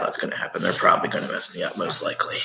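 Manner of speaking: dropping to a whisper; half-whispered joking aside. Real, not acted. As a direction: mutter it under your breath to the adult. Aside while child laughs.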